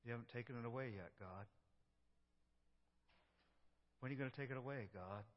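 soft, tired plea to God; a sufferer telling God they are sick of pain; weary complaining prayer. Acted. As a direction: lament quietly, worn out.

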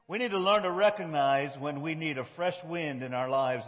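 usual speaking voice

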